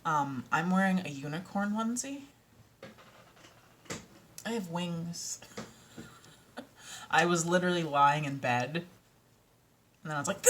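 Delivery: usual speaking voice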